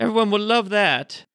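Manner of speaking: high-pitched, haughty talking mirror; scolding magic mirror. Acted. Magic mirror criticising an adventurer's outfit, high and scolding. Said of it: "the magic mirror is telling him".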